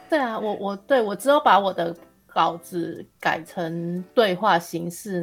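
normal talking voice

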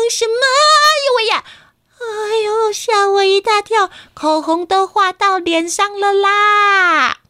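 high, loud startled cry, then slow complaint; startled office lady wailing, lipstick smeared; startled shriek. Acted. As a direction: jump out of your skin and wail about it.

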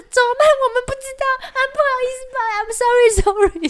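high, fast fake-innocent apology; playing innocent. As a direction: act clueless and sorry, overdone. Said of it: "表现一副我们就是". (装无辜地道歉)

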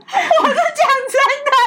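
slow, high words squeezed through laughter; laughing. Real, not acted. Aunt insisting to the host that it is true, laughing. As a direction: insist while still laughing.

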